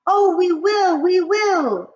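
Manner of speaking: high loud eager witches; witch chorus. Acted. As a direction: agree like a cackling coven. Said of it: "they answered".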